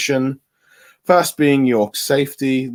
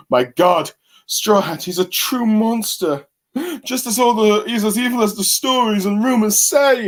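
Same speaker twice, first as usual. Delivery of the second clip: rising higher into a squeaky, shaken gasp; awed Marines; 8 semitones higher than usual. Retold or imitated. Reviewer voicing Marines horrified by Luffy, getting higher and squeakier. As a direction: build from shock to high alarm. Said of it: "the Marines are definitely like".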